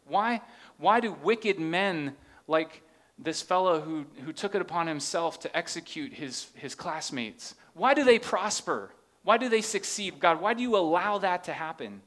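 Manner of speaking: the preacher voicing the grieving nation's why, rising loud; anguished cry. Real, not acted. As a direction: cry out why, pressing harder each time. Pleading why to God.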